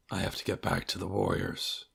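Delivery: a private resolve, said under the breath; quiet inner thought. Acted. Zaryusu's inner thought in a quote.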